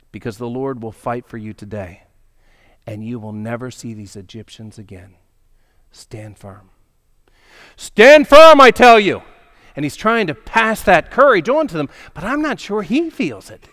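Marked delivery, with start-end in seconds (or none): 0.1-6.7 quiet, lower, steady promise; softer calm reassurance. Acted. Moses quietly promising the Lord will fight, softer. Moses speaks calming words. 7.8-9.2 higher and much louder rallying cry; rally the frightened crowd; emphatic command. Acted. Moses rallying the crowd, loud and high.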